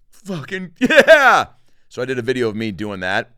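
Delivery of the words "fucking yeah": loud, higher, very slow victory cry; triumphant whoop. Retold or imitated. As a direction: drag out a huge win. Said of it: "he's like".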